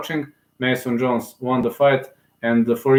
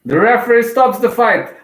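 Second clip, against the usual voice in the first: much higher and louder, announcing the win; excited stoppage call; 10 semitones higher, 5 dB louder than usual. Real, not acted. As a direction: belt out the stoppage. Calls the stoppage live.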